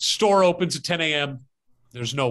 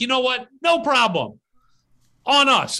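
high, bright and louder with cheer; generous cheerful; 7 semitones higher, 4 dB louder than usual. Retold or imitated. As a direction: wave it off happily, it's on us. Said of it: "He's like".

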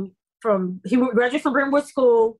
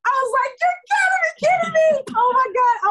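overjoyed crying disbelief; 14 semitones higher than usual. Retold or imitated. Actress told she booked the role, much higher, tearful disbelief. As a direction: choke up in happy disbelief. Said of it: "I was so excited".

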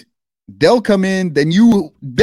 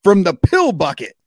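mock gung-ho fan; 5 semitones higher than usual. Reading a fan's bold tweet.

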